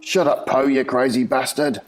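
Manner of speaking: loud Scouse shout; Scouse accent. Acted. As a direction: yell it in Scouse. Barker shouts at Poe.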